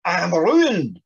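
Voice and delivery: loud, stricken "I am ruined"; despairing cry. Acted. Yvonne despairs aloud before the nurse. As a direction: cry it out as if all is lost. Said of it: "Yvonne cried".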